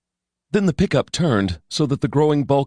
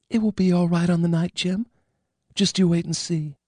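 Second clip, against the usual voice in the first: higher, gentle motherly voice; motherly woman; 7 semitones higher than usual. A narrator's reading. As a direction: reassure him like a mother would. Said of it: "in what might have been a maternal way".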